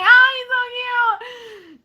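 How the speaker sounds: high, loud swoon over a cutie; gushing. Pretend. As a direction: gush like a smitten movie fan. Imagines herself gushing during the movie.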